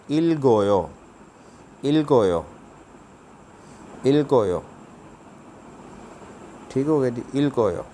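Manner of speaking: इल गोयो with the ल carried into गोयो; Korean linking demo. Shown as a demonstration. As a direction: repeat इल गोयो as linked syllables. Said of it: "second वाला आगे चला जाएगा". (जोड़कर Korean उच्चारण)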